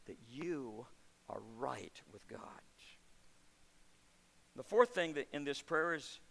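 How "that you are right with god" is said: very soft, low, slow near-whisper; hushed assurance. Acted. Preacher reassuring listeners, very soft slow low close. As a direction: let it sink in gently.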